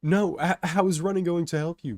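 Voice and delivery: loud, high incredulous yell. A narrator's reading. Crowd objecting as the train nears, incredulous yell. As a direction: shout back in alarm. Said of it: "people from the crowd yell".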